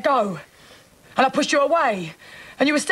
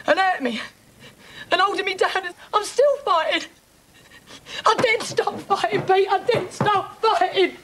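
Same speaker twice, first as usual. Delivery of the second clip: tearful distress; 3 semitones higher than usual. Acted. Woman telling Pete how she fought back, sobbing.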